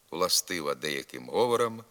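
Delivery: normal talking voice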